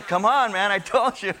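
exasperated wife. Acted. Wife scolding her husband over the wrong exit, exasperated. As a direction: act out an exasperated spouse. Acted quarrel with wife in car.

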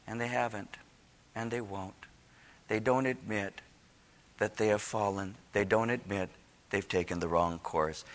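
low, soft and grave; hushed, solemn preaching. Real, not acted. Preacher lamenting that people won't admit they fell, hushed. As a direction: drop right down and let it sink in.

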